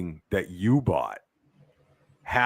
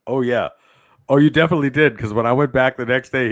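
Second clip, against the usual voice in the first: ordinary delivery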